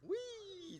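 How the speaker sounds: very high, drawn-out "wheee"; gleeful "wee". Pretend. Pastor reacting to roller coasters for the congregation, high playful squeal. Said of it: "That's a wee!".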